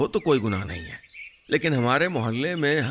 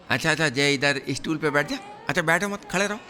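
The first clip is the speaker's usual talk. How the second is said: a lightly voiced sly compounder, quick and a bit higher; cheeky compounder; 3 semitones higher, faster than usual. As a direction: rattle off excuses fast like a smooth-talking helper. Compounder Nankau stalls the patient. (चालाक compounder की हल्की सी बदली आवाज़, तेज़)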